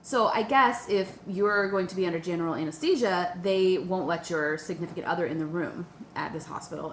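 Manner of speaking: ordinary talk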